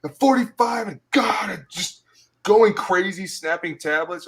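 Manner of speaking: put-on high, overwrought caricature of a stressed forty-five-year-old; frantic old guy. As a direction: play a frazzled vet losing it.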